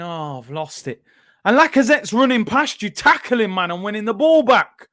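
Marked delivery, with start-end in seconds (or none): 0.0-1.0 a hint of a careless shrug, soft and low; mock lazy striker. Mocking Aubameyang giving the ball away, mild soft mimicry. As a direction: mimic a striker who can't be bothered. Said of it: "running off going".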